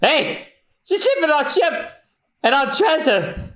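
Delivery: high, shouted dismay; high mock-outraged shout; outraged realization. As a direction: yell as she steals the ship. Pirates realize they're robbed.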